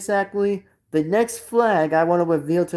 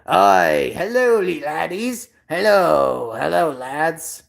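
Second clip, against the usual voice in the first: drawn-out, hearty Scottish greeting; Scottish accent; slower than usual. Acted. Streamer showing off the Scotland accent he likes, in a Scottish accent. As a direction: put on a broad Scottish accent. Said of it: "the Scotland accent by saying is like".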